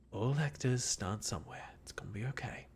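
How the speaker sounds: soft whispered self-reassurance; inner thought whisper. Acted. Reading the game's inner thought line, whispered. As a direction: whisper it to yourself. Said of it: "think to yourself in adorably broken English".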